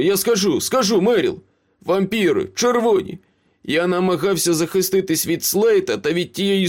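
high-pitched frantic pleading; desperate confession. A narrator's reading. Beaten Ace confesses to Meryl, desperate. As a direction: blurt it out to avoid another blow.

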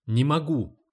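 usual speaking voice

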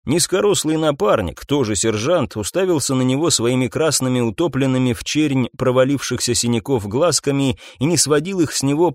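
usual speaking voice

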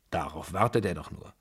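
usual speaking voice

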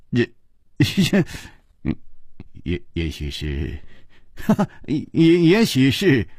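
a light chuckle between the halting words; laughing. A narrator's reading. Chu Liuxiang laughs off his friend's question, lightly. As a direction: stammer it out through a little laugh. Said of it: "笑着说".

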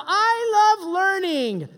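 high, slow goofy voice; mock eager student. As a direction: ham it up again, corrected. Corrected mock student line.

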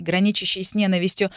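usual speaking voice

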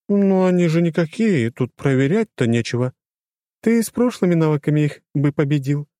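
high female character voice, objecting; Lora, critical companion. A narrator's reading. Lora disputes the hero's fight, critical. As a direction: argue back in a lifted, lighter voice.

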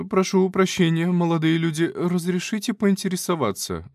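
high, genteel lady's voice; prim lady voice. Acted. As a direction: play a posh baroness. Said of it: "lady character voice".

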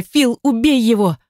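high, urgent order without extra volume; cry out. A narrator's reading. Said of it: "закричала я".

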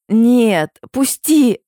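high, loud cry to be released; straining scream. A narrator's reading. Heroine struggling in Asgar's arms, screaming. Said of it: "срывая голос".

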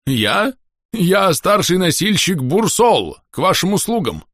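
high, slow porter announcing himself; burbling axolotl porter. A narrator's reading. Porter presents himself to a guest, burbling and eager to serve. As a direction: introduce yourself in a high, drawn-out voice. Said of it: "булькнул Ахилот".

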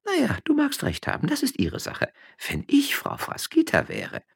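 high, quick gossiping woman; peasant wife voice. A narrator's reading. Josefa gossiping to her husband on the donkey, high chatter. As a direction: pitch up into a chattering farm wife.

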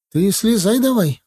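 high-pitched country woman's urging; village woman cutting in. Acted. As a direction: butt in and order him down, voice high. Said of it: "вклинилась колхозница лет пятидесяти". (колхозница вмешивается, высоким голосом)

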